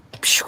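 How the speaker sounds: shooing hiss. Real, not acted. Shooing the pigeon.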